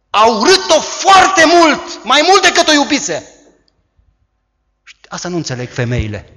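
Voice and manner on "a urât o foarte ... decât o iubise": very high, loud, slow emphatic cry; preaching shout. Real, not acted. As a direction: hammer the point home at full voice. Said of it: "shout heard".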